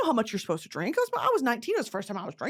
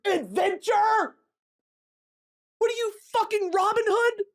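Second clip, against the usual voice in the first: mocking disbelief; 10 semitones higher than usual. Mocking a dating profile.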